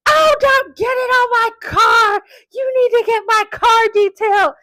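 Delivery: loud, very high-pitched mock shriek; retold screaming. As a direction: imitate a sister screaming about her car. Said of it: "my sister starts screaming".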